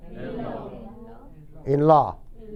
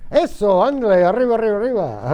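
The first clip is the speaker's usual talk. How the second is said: higher, louder burst of encouragement; excited cheering; 5 semitones higher, 3 dB louder, faster than usual. Real, not acted. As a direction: egg the student on excitedly. Cheering on a student's answer. (ánimo entusiasta)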